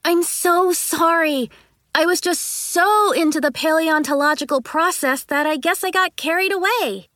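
hammed-up innocent sorry; fake sorry. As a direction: lay the false apology on thick. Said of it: "I cried dramatically".

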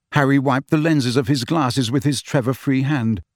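usual speaking voice

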